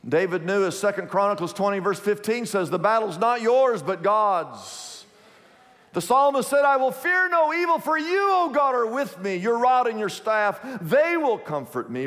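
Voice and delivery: high, ringing preacher's cadence; full-force preaching. Acted. Pastor preaching to his congregation, full force. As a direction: preach it up to the rafters.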